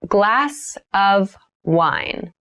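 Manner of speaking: Glass of wine stretched slowly; slow careful repeat. Shown as a demonstration. Teacher models a drink phrase for learners, slowly. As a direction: lay out each word slowly for learners. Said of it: "vocabulary drill, repeated for learners".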